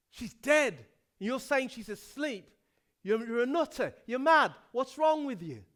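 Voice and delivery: loud, scornful crowd jeering; mocking jeer. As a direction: scoff at him like he is mad. Mockers jeering at Jesus.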